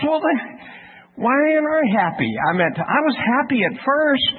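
high-pitched, puzzled objection; acted-out bewildered complaint; plaintive protest.

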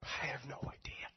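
voiceless, defeated admission; a whisper to the boss of total helplessness; ashamed whisper. Retold or imitated. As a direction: breathe it out in embarrassment. Retold confession, whispered.

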